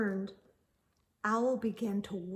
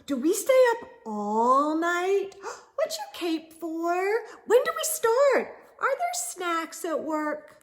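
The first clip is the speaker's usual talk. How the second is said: eager questions; 6 semitones higher, 4 dB louder than usual. Acted. Early Bird peppers Owl with questions.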